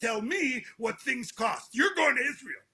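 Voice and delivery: firm final ruling from dad; father laying down law. Retold or imitated. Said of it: "He goes".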